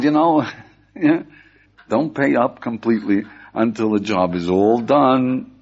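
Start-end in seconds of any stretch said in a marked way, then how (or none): none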